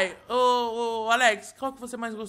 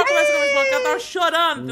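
excited exclaim; 11 semitones higher, 5 dB louder, faster than usual. Real, not acted. Announces talking to wife.

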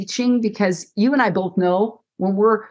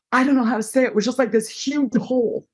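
voicing the survivor; 3 semitones higher, faster than usual. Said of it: "So then she might say".

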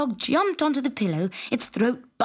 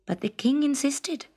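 usual speaking voice

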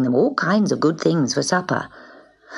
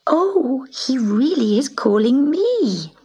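delighted surprise; 8 semitones higher, slower than usual. Acted. Gobolino delighted to be called.

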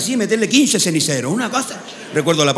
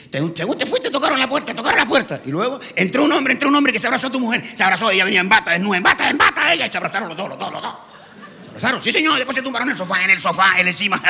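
high, repetitive parrot chatter; excited parrot; 6 semitones higher than usual. Acted. Parrot telling the owner what happened, excited high chatter. Parrot reports the wife's affair.

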